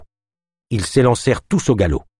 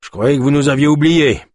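raised-pitch grumbling character voice; 5 semitones higher, 3 dB louder than usual. A narrator's reading. Freed trapper Kolb to his rescuers, grumbling. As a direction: grumble it in a put-on voice. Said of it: "grogna Kolb".

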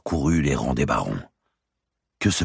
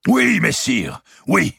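Clermont's eager assent; 11 semitones higher, 6 dB louder, slower than usual. A narrator's reading. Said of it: "dit Robert de Clermont".

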